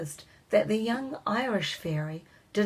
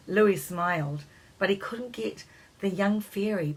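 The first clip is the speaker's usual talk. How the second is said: usual speaking voice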